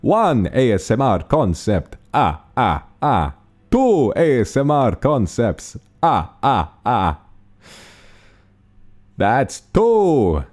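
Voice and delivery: high, loud, drawn-out Count with counting laughs; Count vampire voice. Acted. Streamer shows viewers their vampire impression, hammy Count voice. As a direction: ham it up as a slow, ringing cartoon vampire count. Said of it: "the count voice if I was a vampire".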